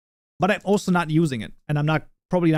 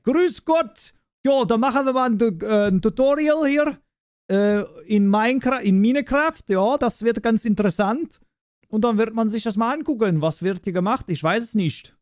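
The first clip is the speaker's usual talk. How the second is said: high, animated German presenter patter; German tutorial host; 5 semitones higher than usual. Pretend. Streamer joking about a German April Fools tutorial, playful high-pitched host. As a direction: launch cheerily into a higher-pitched German greeting. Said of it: "Do a fully German tutorial".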